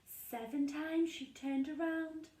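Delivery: usual speaking voice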